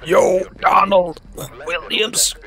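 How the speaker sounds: high slow taunting call. Acted. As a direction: taunt the ghost, drawn out. Taunting the ghost.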